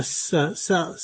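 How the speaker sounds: "S" voiced as the sound "suh"; "S" as a repeated hissed "suh"; soft S sound. Shown as a demonstration. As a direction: say "S" as a sound, suh, not the letter name. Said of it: "pronounced with a S, S sound".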